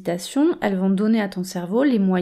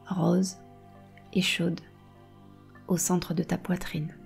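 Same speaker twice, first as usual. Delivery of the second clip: right in your ear. Acted. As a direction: breathe calm into every phrase. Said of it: "Je vais te guider dans une méditation".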